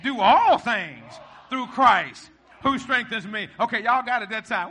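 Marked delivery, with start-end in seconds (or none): 0.0-3.5 loud, slow declaration with attitude; drawn-out shout, full of conviction; rubber-neck sass. Shown as a demonstration. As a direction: tell your neighbor with a neck-rolling swagger. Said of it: "put some rubber in your neck".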